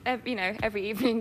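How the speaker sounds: normal talking voice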